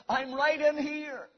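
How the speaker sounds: ordinary talk, nothing marked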